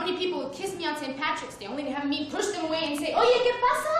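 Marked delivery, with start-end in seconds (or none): 3.1-3.9 sudden loud, high Spanish brush-off; indignant Spanish retort. Retold or imitated. To a stranger kissing her on Saint Patrick's Day, loud high Spanish retort. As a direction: snap back in Spanish, pushing them off. Pushing away St Patrick's kissers.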